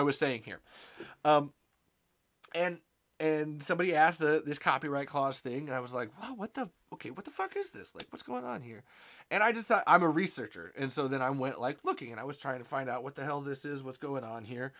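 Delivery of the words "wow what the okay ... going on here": high, hushed disbelief; mock bafflement. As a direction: puzzle aloud softly, pitch jumping up. Retelling his own baffled reaction.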